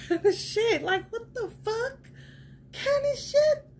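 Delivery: high, laughing, near crying; laughing squeal. Real, not acted. Telling viewers about the kidnapper, laughing high. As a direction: squeal through laughter as the story gets absurd. Laughing at her own story.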